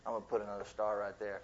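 a playful God tossing out another star; God amused. Retold or imitated. Preacher voicing God teasing the scientists, chuckling. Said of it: "And God continues to laugh".

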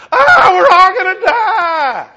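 screamed very high and loud, slow and laughing; hammed-up panic. Preacher acting Wesley screaming in the storm, comic panic. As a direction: wail like a man sure the ship is sinking. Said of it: "I can just see John Wesley going".

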